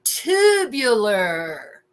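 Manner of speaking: put-on very slow, drawn-out teen slang; old slang exclamation. Imitating ninth-grade slang of the past, drawn-out awe. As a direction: stretch it out in awed slacker style. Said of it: "People used to say".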